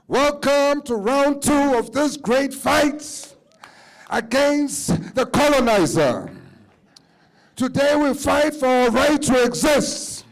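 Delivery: booming fight-night ring announcer; roaring rallying speech. Acted.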